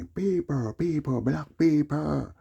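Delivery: high, loud, slow put-on voice; drawn-out high disguised call. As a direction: stretch it out high and loud so nobody knows you. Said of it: "which I never speak like that".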